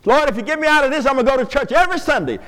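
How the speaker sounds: rushed, fast mock bargaining with God; bargaining prayer. Pastor mimicking people bargaining with God, fast mock plea. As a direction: rattle off a deal with God. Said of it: "Have you heard people talk like that?".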